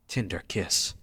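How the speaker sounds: ordinary talk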